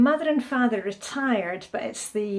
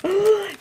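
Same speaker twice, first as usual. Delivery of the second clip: a sharp high gasp of delight; 9 semitones higher than usual. Real, not acted. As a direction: gasp with glee at the food. Sees deep fried Mars bar.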